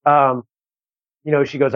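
usual speaking voice

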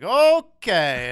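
high, loud, drawn-out reaction; awkward, exaggerated "oh-kay"; taken-aback okay. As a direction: stretch it upward in mock alarm. Retold reaction to daughter watching show.

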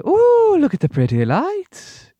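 high, slow and eerie; creepy childlike voice. Acted. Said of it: "Her eyes just simply track them and say".

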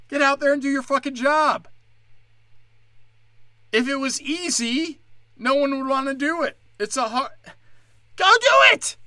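rising into a furious shout at lazy comics; building furious yell. Real, not acted. Ranting at the comic who cancelled, building to an angry shout.

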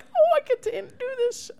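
high squealy fangirl gush; starstruck squeal. Retold or imitated. Acting out own starstruck thrill.

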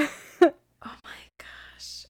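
slow breathless whisper. Real, not acted. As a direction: whisper it, scandalised. Reacting to steamy passage.

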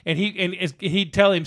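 normal talking voice

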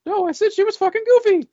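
very high, loud cartoon mouse squeak; imitated punchline teller. Mimicking the co-host's joke at the bar, squeaky mouse voice. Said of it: "I can just picture walking in like".

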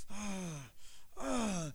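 soft, breathed-out "ah"; nerve-gathering sighs. Acted. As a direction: heave breaths to summon courage. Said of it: "trying to build up this, this courage".

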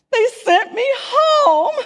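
high, slow, half-crying confession; laughing through tears. Retold or imitated. Confessing to husband, laugh-cry.

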